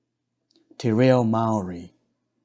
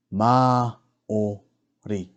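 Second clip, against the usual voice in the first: "Māori" slowly split as Mā, o, ri; slow teaching breakdown of "Māori"; broken into syllables; much slower than usual. Shown as a demonstration. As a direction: sound out each part of "Māori" with gaps. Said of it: "break down the words first".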